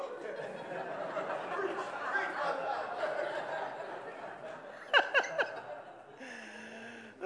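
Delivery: breathless wheezing laugh; real laugh that runs away with him; long laugh. Real, not acted. Pastor cracking up before his confession, long laugh.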